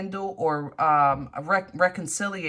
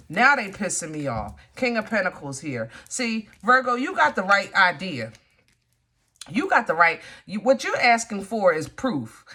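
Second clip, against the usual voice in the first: usual speaking voice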